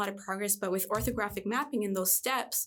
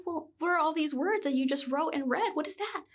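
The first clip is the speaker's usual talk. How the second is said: mock surprise; 7 semitones higher than usual. Teacher surprised by his doubt.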